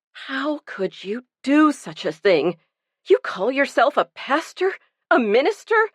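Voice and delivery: rising high on the final jab; Lucy scorning the minister's hypocrisy; disgusted. A narrator's reading. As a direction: sneer the questions at the pastor. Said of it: "curled her lips in disgust".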